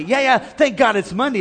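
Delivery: brighter, bouncier take on Monday; cheerful. Shown as a demonstration. Preacher showing the upbeat way to greet a Monday. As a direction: say it upbeat, with a lift. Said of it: "Don't just say".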